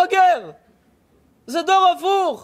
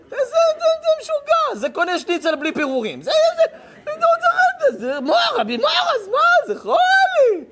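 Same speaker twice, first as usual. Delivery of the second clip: mocking outrage; 13 semitones higher than usual. A preacher mocking a teen with a fake Rolex, high exasperated shout. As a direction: ham up the disbelief.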